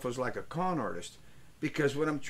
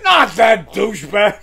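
louder, dragged-out scornful bark; mock shout; 4 semitones higher, 14 dB louder than usual. Quoting Racetrack Mike's scorn, louder mock shout. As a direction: shout it like a disgusted old racetrack guy. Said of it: "You know what he said?".

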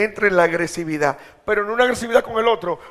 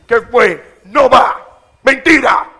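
loud, higher shouted command to the devil; commanding rebuke; 5 semitones higher, 14 dB louder, much slower than usual. Shown as a demonstration. Preacher confronting the devil in his living room, shouting. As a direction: face the devil and shout him down. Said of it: "De tú a tú, hablarle".

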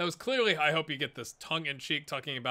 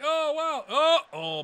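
mock excitement; 12 semitones higher, 8 dB louder, slower than usual. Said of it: "It's like".

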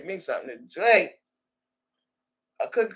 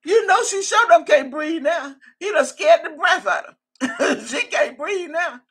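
laughing; 8 semitones higher, 3 dB louder than usual. Real, not acted. Laughing while commenting on a story.

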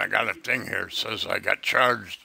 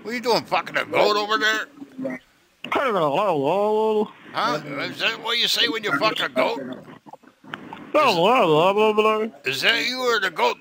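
mocking taunt; 8 semitones higher, slower than usual. Taunting the scammer.

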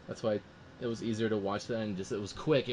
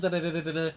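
louder, higher machine gun sounds; mock machine gun; 7 semitones higher, 5 dB louder, faster than usual. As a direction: rattle out gunfire with your mouth. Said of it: "my machine guns".

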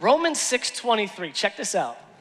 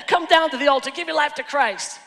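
lightly mimicked pastor's urging, pitched higher; imitated altar call; 7 semitones higher, 3 dB louder than usual. Pastor calls sinners to the altar, lightly imitated urging. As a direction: urge it like a hurried altar call. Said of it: "he probably had an altar call".

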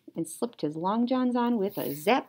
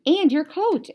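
higher, louder And your coat; mother adding; 9 semitones higher, 8 dB louder than usual. Acted. Mother reminding Froggy, higher and louder. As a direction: tack it on with a sharp reminder. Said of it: "added his mother".

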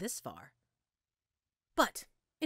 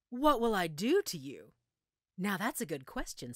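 ordinary delivery

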